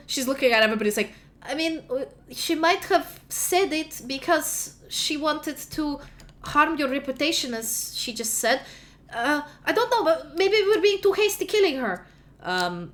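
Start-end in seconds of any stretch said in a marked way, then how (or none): 1.4-12.1 high, hesitant, nervous voice; nervous pleading. Acted. Luba nervously urges the court to ask questions. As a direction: plead timidly, full of ums.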